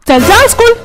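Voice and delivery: cross command. Acted. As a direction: send the boy packing. Father orders son to school.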